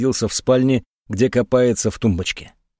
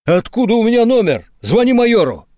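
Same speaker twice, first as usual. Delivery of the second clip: high, louder panicked reply; urgent shout; 9 semitones higher, 3 dB louder than usual. A narrator's reading. Monk answers Dobrodeev in alarm, shouting. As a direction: cry out in alarm. Panicked exchange watching burglar.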